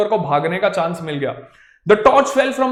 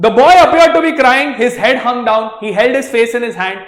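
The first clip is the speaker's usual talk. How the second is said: louder, slow spooky storytelling; dramatic narration; 3 semitones higher, 8 dB louder, much slower than usual. A narrator's reading. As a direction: read it like a ghost story. (डरावनी कहानी सुनाना)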